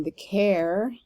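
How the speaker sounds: "care" drawn out after a light "the"; deliberate iambic lean on "care"; stress on CARE. Shown as a demonstration. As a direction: land the beat on "care". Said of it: "the emphasis on the care of carriage".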